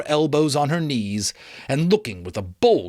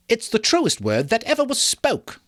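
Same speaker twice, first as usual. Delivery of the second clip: higher voice, eager agreement; Mrs Dilber; 11 semitones higher than usual. Acted. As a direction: agree with relish. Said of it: "said Missus Dilber".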